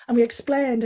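ordinary delivery

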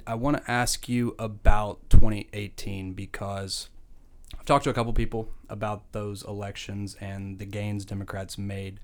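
ordinary delivery

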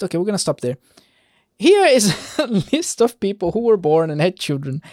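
ordinary delivery

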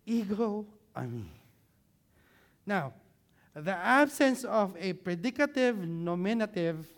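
ordinary talk, nothing marked

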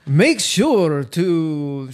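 slow, loud hammed-up Dracula; vampire voice. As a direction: drag it out like Count Dracula. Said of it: "your vampire impression".

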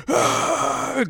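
very high mock ape shriek; long, high imitated animal cry; insane gorilla scream. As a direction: scream like a gorilla gone berserk. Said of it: "the gorilla fucking goes insane".

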